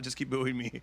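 normal talking voice

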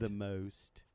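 put-on British echo; British accent drill. Guest repeating after the host, British elocution. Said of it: "Okay, try this".

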